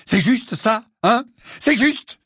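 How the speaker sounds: high, loud indignant questioning; furious. A narrator's reading. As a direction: demand it in angry bursts. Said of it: "Il était furieux".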